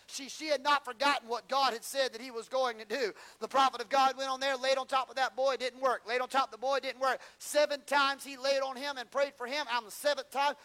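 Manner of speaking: loud, high, driving preacher's climax; preaching at full force. Acted. Preacher drives home the promise to the congregation, full-force preaching. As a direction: lift the room, hammer each line.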